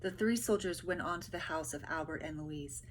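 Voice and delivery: normal talking voice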